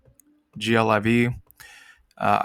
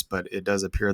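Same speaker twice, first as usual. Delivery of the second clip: ordinary talk, nothing marked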